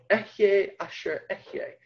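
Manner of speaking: softly voiced Hebrew "Ehyeh asher ehyeh"; Hebrew pronunciation. Shown as a demonstration. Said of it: "I am who I am".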